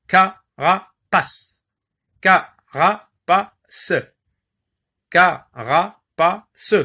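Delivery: "Carapace" drawn out slowly as ca-ra-pace; slow syllables. Shown as a demonstration. Teacher dictating a word for syllable counting. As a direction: stretch "Carapace" so each beat is heard. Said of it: "vous entendez combien d'unités de son".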